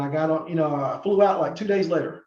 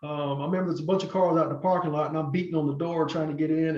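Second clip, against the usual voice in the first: normal talking voice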